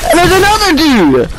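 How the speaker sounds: high-pitched exclamation of disbelief; real, high startled outburst. Real, not acted. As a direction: cry out as an enemy pops up. Another enemy appears in the fight.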